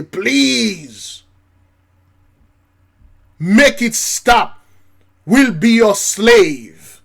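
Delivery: loud, high, slow begging; pleading elite. Pretend. Groveling billionaire begging the preacher's people, loud. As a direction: beg like a desperate rich man.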